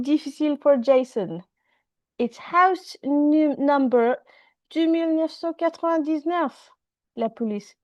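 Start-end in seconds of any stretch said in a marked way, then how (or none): 4.7-6.7 rattled off fast. Acted. [name] rattles off house number.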